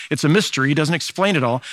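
ordinary delivery

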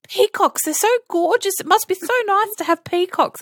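high, gushing mock visitor voice. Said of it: "Everyone goes".

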